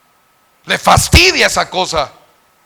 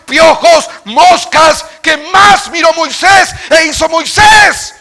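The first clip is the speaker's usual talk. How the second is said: rising, ever louder sermon litany, high by the end; preaching building to full force; 7 semitones higher, 8 dB louder than usual. Acted. Preacher listing Moses' miracles, building from firm to shouting. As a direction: build the list to a climax.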